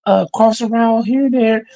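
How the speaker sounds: lightly mocked meek coach; soft coach voice. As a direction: mimic a soft-spoken coach. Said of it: "that is soft shit".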